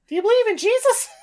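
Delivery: high, fast cartoon hedgehog voice; squeaky fast Sonic impression. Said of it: "But in the Sonic voice".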